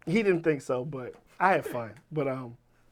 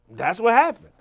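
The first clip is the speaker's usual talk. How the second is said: usual speaking voice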